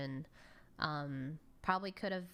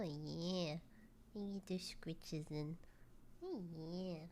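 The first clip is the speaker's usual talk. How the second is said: overwhelmed by cuteness; 4 dB quieter than usual. Real, not acted. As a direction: melt over a cute little animal. Baby-talking to a cute pet.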